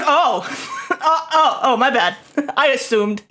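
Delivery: giggling through an embarrassed oops; sheepish laughing sorry; laughing apology. Real, not acted. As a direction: laugh while backpedaling.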